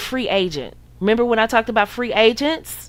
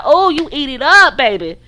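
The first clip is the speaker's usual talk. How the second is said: louder, with a hint of a sultry put-on voice; mock swooning woman; 4 semitones higher, 4 dB louder than usual. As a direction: tease her for lapping it up. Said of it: "And you like".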